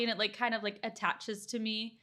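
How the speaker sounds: ordinary talk, nothing marked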